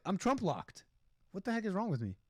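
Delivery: ordinary delivery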